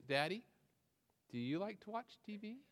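put-on soft, hesitant little-girl hint; shy little girl. A touch of a shy four-year-old angling for TV, soft. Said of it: "very shyly and timidly".

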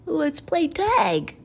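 high, loud invitation; cartoon pal rallying friends; excited playmate. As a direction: call it out to the other kids. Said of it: "said Baxter".

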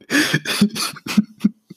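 real unvoiced burst of laughter; breathy laugh. Real, not acted. Laughing at the coworker who fell in the river, real wheezing laugh. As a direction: let a silent wheezing laugh out.

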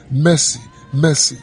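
ordinary delivery

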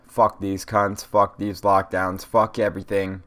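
ordinary delivery

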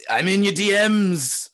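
hammy Irish accent impression, slow. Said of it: "my Irish accent is horrible".

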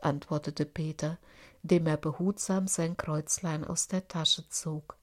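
plain reading voice

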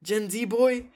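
mock the kid with a sneer; scoffing adults. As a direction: adults scoffing at a kid's podcast, high mocking scorn.

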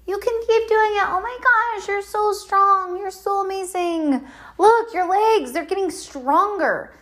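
mock hype girl cheering on legs; gushing cheerleader. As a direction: rave like an over-the-top fan. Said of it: "I need someone that's like".

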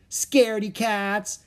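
slow, high, loud taunt; taunting shout. A narrator's reading. Annie after the fleeing hyenas, taunting shout. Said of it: "Annie shouted after them".

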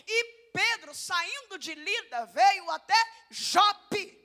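slow, loud Bible reading; loud scripture reading. Real, not acted. Preacher reads of Peter arriving in Joppa, slow loud reading. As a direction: proclaim it slowly.

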